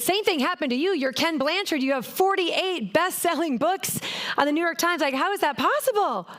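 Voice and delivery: high-pitched, disbelieving protest; incredulous reply. Retold or imitated. Mentee to her famous mentor who claims the same struggle, incredulous. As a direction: challenge him in amazed disbelief.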